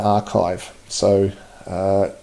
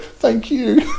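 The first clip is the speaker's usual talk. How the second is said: high-pitched, laughing gratitude; giggly and high; laughing thanks; 13 semitones higher, 4 dB quieter than usual. Real, not acted. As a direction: squeak out thanks through a laugh. Touched, amused by viewer's encouragement.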